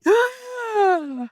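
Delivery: high, shocked sharp gasp; big gasp. Retold or imitated. She hears the secret password, huge shocked gasp. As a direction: gasp as if the sign just came true.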